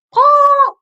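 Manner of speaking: sharp, high, loud burst like a startled lizard; skink threat noise. Retold or imitated. Showing the kids how the skink scares predators: loud high threat burst. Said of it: "and he goes".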